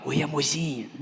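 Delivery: low, soft lover's voice; hushed sweet talk. Retold or imitated. Boyfriend to girlfriend on a public payphone, hushed and secretive. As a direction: keep it secret from listeners nearby. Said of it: "Fala mais alto".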